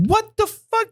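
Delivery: sudden high-pitched disbelief; real stunned what the fuck; shocked outburst. Real, not acted. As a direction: blurt it out in shock. Shocked at worst grade.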